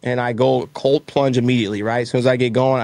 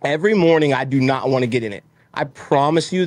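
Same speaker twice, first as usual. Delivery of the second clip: usual speaking voice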